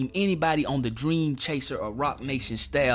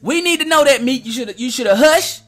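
higher and louder, calling Meek out; loud demand; 7 semitones higher, 8 dB louder than usual. Real, not acted. Repeats demand, then yells it.

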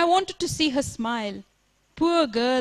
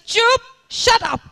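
sharp, much higher and louder; theatrical yell; 10 semitones higher, 5 dB louder than usual. Acted. Leela silencing her singing mother, hammed-up yell. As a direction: cut her off with a hammed-up shout. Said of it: "Leela screamed theatrically".

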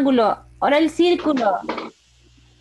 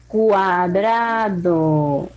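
very drawn-out syllables, lower pitch; slow dictation; 4 semitones lower, much slower than usual. Shown as a demonstration. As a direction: stretch the word right out for young writers. Teacher dictates the word to write.

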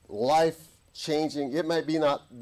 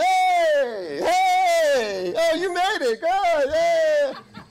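loud, very high hollered welcome; hammed-up ecstatic shout of hello; joyful greeting; 7 dB louder, much slower than usual. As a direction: holler like spotting a long-lost friend. Acted joyful greeting in heaven.

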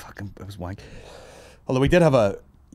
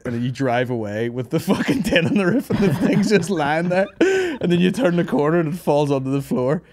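laughing through words; 7 semitones higher, 3 dB louder than usual. Real, not acted. Retelling while laughing.

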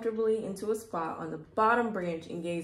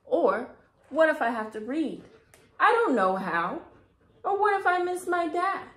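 put-on rising, louder worried little girl; lightly voiced worried girl; worried child; 6 semitones higher, 6 dB louder than usual. A narrator's reading. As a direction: list the fears, building up. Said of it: "said Rosie".

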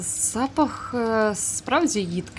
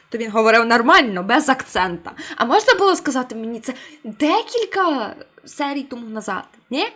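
loud; 2 semitones higher, 8 dB louder than usual. Real, not acted. Complaining at the game.

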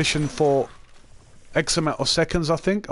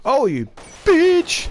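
high, drawn-out yell at an enemy; gamer's outburst; 10 semitones higher, 4 dB louder, much slower than usual. Real, not acted. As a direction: stretch the insult into a high cry. Yells at enemy in game.

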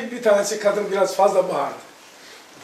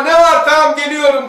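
calling out from the toilet; 5 semitones higher, 6 dB louder than usual. Retold or imitated. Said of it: "diye cevap veririz".

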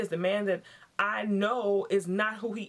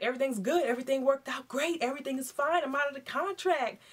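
fake cheerful lie; 7 semitones higher than usual. Husband lying to his wife.

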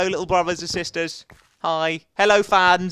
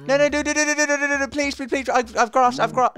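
high, loud frantic begging; desperate pleading; 10 semitones higher, 3 dB louder than usual. Real, not acted. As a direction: beg it not to get away. Animal running away, begging it.

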